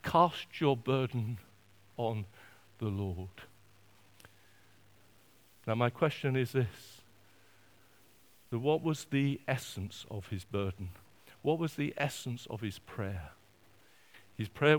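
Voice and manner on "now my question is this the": normal talking voice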